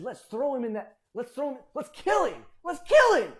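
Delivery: starts eager, ends in a loud shout of kill him; building murderous shout. Acted. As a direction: whip the brothers up from scheming to yelling. Brothers scheming to kill Joseph.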